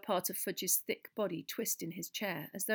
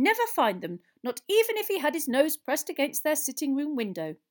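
high, loud piping voice held throughout; excitable little scholar explaining, pitched up; squeaky; 9 semitones higher, 10 dB louder than usual. A narrator's reading. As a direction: lecture on magic as a tiny squeaky professor. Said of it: "he said squeakily".

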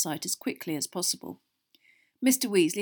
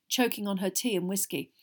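usual speaking voice

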